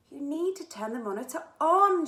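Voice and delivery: urging Zia at the controls. A narrator's reading. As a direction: push her to act now. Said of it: "she urged".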